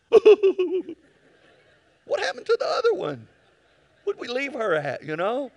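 high, loud voice of the wife, with a laugh; wife voiced. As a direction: play the wife, bewildered and laughing. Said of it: "She goes".

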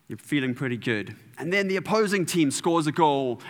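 usual speaking voice

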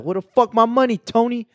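high-pitched mock mobster threat; hammed-up debt collector, pitched well up; mobster shakedown. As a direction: demand it like a comic TV gangster. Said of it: "That man look like he'll beat you".

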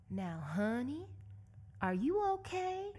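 drawn-out Southern drawl climbing high on the question; deep Southern accent. Retold or imitated. Woman checking on a stranded cyclist, deep Southern accent. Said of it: "In a deep Southern accent".

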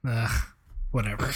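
low, dismissive sigh of annoyance; annoyed ugh. Real, not acted. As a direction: shrug it off, annoyed. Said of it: "You're not helping".

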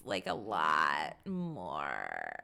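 dropped low and dragged out in a creaky fry; low creaky vocal fry; vocal fry demo. Shown as a demonstration. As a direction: croak it slowly like a bored teen. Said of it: "young kids are doing this".

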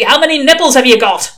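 loud, exasperated demand; testy. A narrator's reading. Old witchfinder pressing a baffled recruit, testy shout. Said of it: "said the voice testily".

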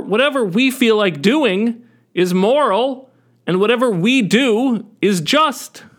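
hammed-up moral certainty, stretching key words; mock self-righteousness. Stone throwers justify hating bad people, mock self-righteous lecturing. Mock crowd sure of its virtue.

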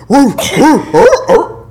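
high yelping barks; dog bark imitation. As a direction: yelp like a dog. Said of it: "that dog was like".

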